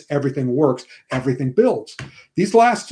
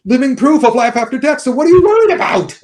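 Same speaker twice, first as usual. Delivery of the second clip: builds higher and louder to a ringing end; rally them, rising higher each line; imagined Venkman speech; 8 semitones higher, 4 dB louder than usual. Retold or imitated. Venkman reassuring the scared team, rising and loud. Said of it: "what he should have said".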